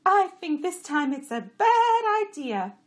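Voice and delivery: high, loud, slow donkey voice; complaining jenny. A narrator's reading. Jenny grumbling about the road, high and loud. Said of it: "Chamra said".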